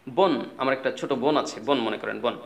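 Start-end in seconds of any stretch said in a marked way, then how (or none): none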